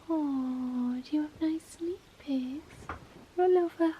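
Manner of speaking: ordinary talk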